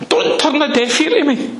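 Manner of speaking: high, begging plea with a laugh in it; pleading prayer. Acted. Preacher acts out pleading prayer.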